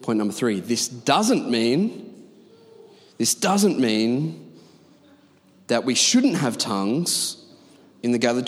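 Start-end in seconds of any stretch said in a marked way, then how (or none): 0.6-4.5 slow, drawn-out DOESN'T with a swoop in pitch; stress on DOESN'T. Real, not acted. Preacher making point number three, DOESN'T drawn out emphatically. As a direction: stretch out the word doesn't for emphasis.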